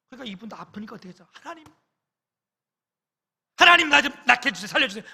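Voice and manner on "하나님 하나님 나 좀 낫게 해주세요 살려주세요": higher, louder, fast begging to be saved; desperate pleading prayer. Retold or imitated. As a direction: cry out to God in pain. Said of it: "막 기도했대". (고통 속 다급하게 살려 달라는 기도)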